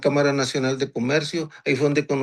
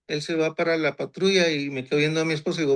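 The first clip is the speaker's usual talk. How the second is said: ordinary talk, nothing marked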